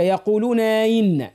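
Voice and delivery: يقولون glued to آئنا with no clear hamza; wrong slurred hamza. Shown as a demonstration. Teacher warning against this, slurred quick آئنا. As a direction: slide straight into آئنا, skipping its glottal start. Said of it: "ما تقولش هكا".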